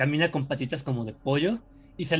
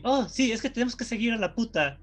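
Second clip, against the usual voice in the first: higher, lightly hammed-up cartoon hero line; mock dub line; 8 semitones higher than usual. Recalling the Spanish dub, lightly hammed-up character voice. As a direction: toss it off like a dubbed anime kid. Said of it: "doblado al español".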